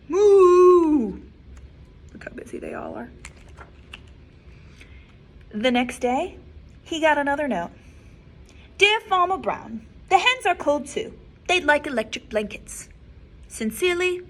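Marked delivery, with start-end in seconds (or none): 0.1-1.2 loud high mooing cow; big cow moo. Acted. Librarian reading aloud booms the cows' moo. 8.8-14.2 higher, louder reading of the note; cows' letter. Acted. Librarian voices the cows' letter to Farmer Brown.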